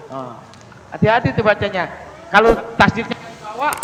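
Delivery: usual speaking voice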